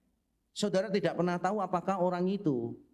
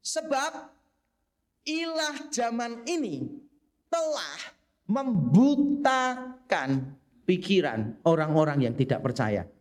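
slow emphatic reading; 2 semitones higher, 4 dB louder, slower than usual. Shown as a demonstration. Preacher to congregation, slow and weighty. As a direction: read the Greek sense slowly, leaning on each phrase. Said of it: "terjemahannya begini".

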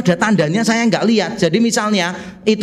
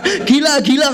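laughing exclamation; 7 semitones higher than usual. Retold or imitated.

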